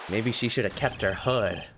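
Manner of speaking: ordinary delivery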